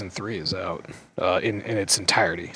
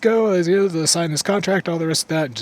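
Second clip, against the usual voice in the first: high-pitched rattled-off formalities; brisk acted goddess; 10 semitones higher, 4 dB louder than usual. Goddess rushing the hero's contract, high brisk retelling. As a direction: hurry through it as a done deal. Acts goddess hurrying the deal.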